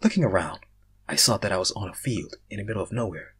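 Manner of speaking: ordinary talk, nothing marked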